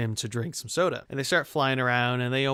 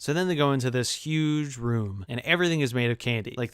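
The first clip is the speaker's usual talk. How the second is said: ordinary talk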